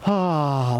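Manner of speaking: long exhaled ah sound like a sigh. Shown as a demonstration. Teacher demonstrating the waterfall breath, sighing ah. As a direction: let the breath fall out as an open ah. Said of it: "like a sigh".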